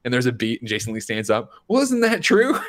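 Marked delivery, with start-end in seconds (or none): 1.7-2.6 high-pitched heckler's question; hammed-up retold challenge; mock challenge. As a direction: pipe up with an exaggerated, needling question. Acts out heckler standing up to challenge.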